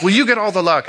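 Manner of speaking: put-on a petty dig at a lucky kid; snarky. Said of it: "saying something a little snarky".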